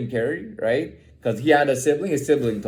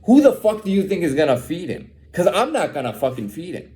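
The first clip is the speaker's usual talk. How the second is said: ordinary delivery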